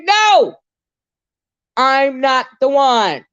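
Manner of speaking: a sudden high, loud no; shout. Real, not acted. Streamer to viewers, refusing shame with a shouted no. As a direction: shout the idea down hard.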